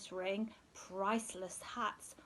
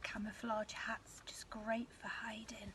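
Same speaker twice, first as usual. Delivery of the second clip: soft secret whisper, as if hiding; 10 dB quieter than usual. Acted. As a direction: whisper so nobody finds you. Hiding in camouflage, hushed.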